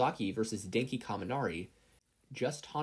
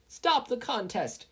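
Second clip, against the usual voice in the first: higher, louder, slow shout; yelled; 13 semitones higher, 5 dB louder, slower than usual. A narrator's reading. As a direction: halt the game with a yell. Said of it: "Midnight yelled".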